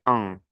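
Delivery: "an" as a nasal vowel. Shown as a demonstration. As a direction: say "an" through the nose, no full n. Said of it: "este es nasal". ("an" nasal, sin pronunciar la n)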